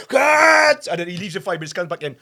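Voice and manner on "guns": retold yell. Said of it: "he goes".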